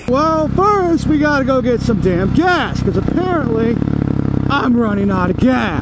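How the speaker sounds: muffled through a helmet mic over wind; helmet mic while riding. Real, not acted. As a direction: talk from inside a helmet on the road.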